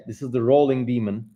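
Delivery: usual speaking voice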